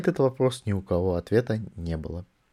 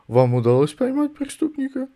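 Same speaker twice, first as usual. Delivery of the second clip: sly prince voice; 14 semitones higher than usual. A narrator's reading. Prince asks Vimes slyly.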